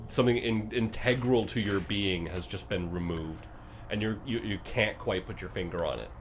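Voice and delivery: usual speaking voice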